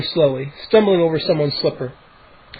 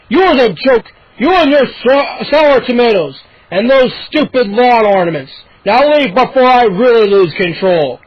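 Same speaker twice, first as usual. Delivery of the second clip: loud, higher furious neighbor; angry threat; 5 semitones higher, 5 dB louder than usual. A narrator's reading. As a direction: drive him off your porch.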